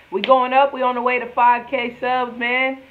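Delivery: loud, high hype; excited. Real, not acted. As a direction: pump up the outro. Excited outro sign-off.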